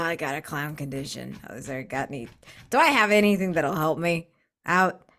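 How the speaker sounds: usual speaking voice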